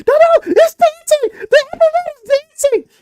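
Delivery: very high, loud squealing; ecstatic kid. Acted. Kid to parents as bastion dances, ecstatic squeal. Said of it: "And he's like".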